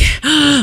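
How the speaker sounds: short sharp gasp, imitating herself; a quick startled intake of breath; retold gasp. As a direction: catch your breath in mock shock. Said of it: "I was like".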